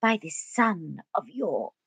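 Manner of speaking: posh theatrical soliloquy with drawn-out final words; declaimed verse, plummy and overdone; hammed-up Shakespeare. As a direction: ham it up like a grand stage actor. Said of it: "I'd just ham it up".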